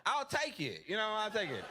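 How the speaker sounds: usual speaking voice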